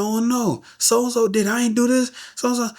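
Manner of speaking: high-pitched, distressed pleading; panicked denial. Retold or imitated. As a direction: plead your innocence to grieving family, voice way up. Panicked denial to grieving family.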